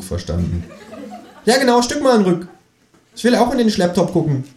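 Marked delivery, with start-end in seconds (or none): 1.4-4.4 high, perky kangaroo voice; talking kangaroo. Acted. Kangaroo asking the narrator to move over, high cheeky voice. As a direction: squeak it out like a cheeky animal.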